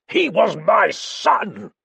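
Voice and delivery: very high, loud and slow cry of grief and rage; roared in grief; anguished roar. A narrator's reading. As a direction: let it ring through the room. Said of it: "That terrible voice rang".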